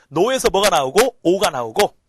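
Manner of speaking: loud, slowed call-out, rising at "나오고"; loud drilling. Shown as a demonstration. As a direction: belt out the memory cue for the class.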